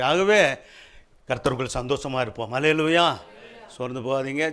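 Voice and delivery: ordinary delivery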